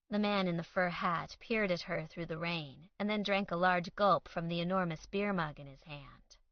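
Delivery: ordinary delivery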